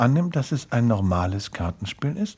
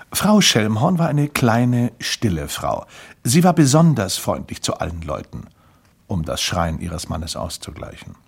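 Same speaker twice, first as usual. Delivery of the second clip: plain reading voice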